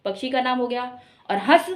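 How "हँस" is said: हंस meant as हँस, the verb, no full n; हंस firmly as hãs, straight into s; chandrabindu sound. Shown as a demonstration. Teacher names the verb, हंस said as हँस. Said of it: "हंस एक क्रिया हो गई".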